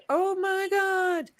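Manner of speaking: drawn-out high "Oh my God", slow and stunned; mock amazed gasp. Telling a friend about meeting a hiker, mock amazed. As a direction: act floored by it, high and slow. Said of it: "It's like".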